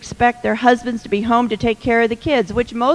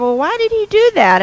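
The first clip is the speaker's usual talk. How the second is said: high, fast, mock-indignant mother; indignant mom; 11 semitones higher, 4 dB louder, faster than usual. Mom indignant at Jim on the phone, high and quick. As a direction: play the outraged mom siding with her daughter.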